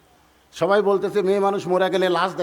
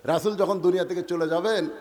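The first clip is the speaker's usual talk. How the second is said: ordinary talk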